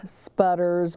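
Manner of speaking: usual speaking voice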